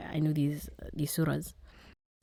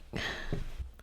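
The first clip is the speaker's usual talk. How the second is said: soft tearful laugh; 7 dB quieter than usual. Real, not acted. Embarrassed while recalling crying.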